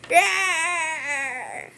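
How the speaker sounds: a short, throaty mock roar; monster growl. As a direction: growl it like an angry monster in a kid's retelling. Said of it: "He's like".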